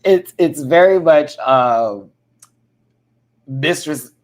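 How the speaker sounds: normal talking voice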